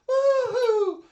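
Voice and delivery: high, loud whooping scream; screamed woohoo. Acted. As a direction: let out a long, high "woohoo". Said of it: "screaming out".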